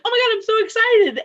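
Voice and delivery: high, loud gush of excitement; excited fan. Retold or imitated. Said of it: "anybody would be like".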